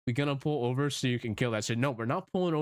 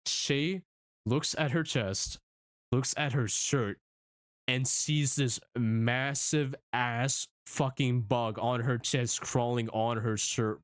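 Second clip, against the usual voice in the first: usual speaking voice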